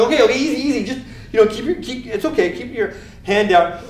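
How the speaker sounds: high-pitched, urgent mock soothing; acted nervous calming, easing off in volume; anxious placating. Medic dodging blood while calming patient, anxious placating.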